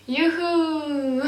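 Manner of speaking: long drawn-out, falling woo-hoo; mock cheer. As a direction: cheer half-heartedly at bad news. Mock cheer at getting period.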